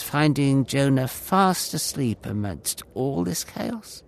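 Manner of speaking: usual speaking voice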